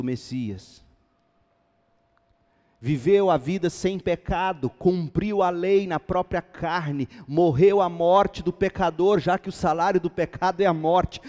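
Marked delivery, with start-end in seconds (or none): 2.8-11.2 raised voice building to loud, high proclamation; building preaching. Real, not acted. Preacher recounting Christ's life and resurrection, building full-force preaching. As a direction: build the gospel summary to a climax.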